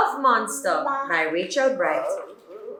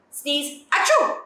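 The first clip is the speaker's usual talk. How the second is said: mock sneeze; 3 semitones higher, slower than usual. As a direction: act out a big sneeze. Sneeze and poop game.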